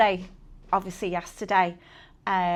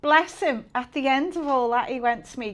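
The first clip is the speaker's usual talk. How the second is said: ordinary talk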